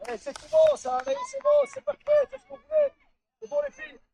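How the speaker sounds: high, loud praise yelled to the team; loud, happy praise shouted from the bench; excited cheering. Real, not acted. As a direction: cheer them on with delight. Coach praising team from sideline.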